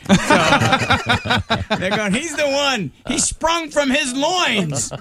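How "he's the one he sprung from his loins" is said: mock audience heckle. Audience mocking Bobcat, acted quote.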